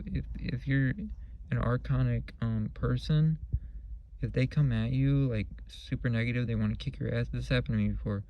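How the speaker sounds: ordinary talk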